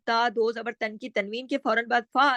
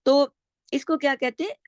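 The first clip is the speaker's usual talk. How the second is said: ordinary talk, nothing marked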